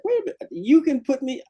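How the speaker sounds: high, eager plea to be let in; eager pleading. Retold or imitated. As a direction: push your case, voice up high. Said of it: "I'm like".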